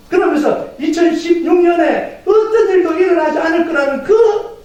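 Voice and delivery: high, driving sermon delivery; impassioned preaching. Real, not acted. Preacher recalls last year's pain to congregation, impassioned preaching.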